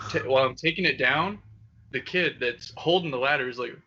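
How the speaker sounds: ordinary talk, nothing marked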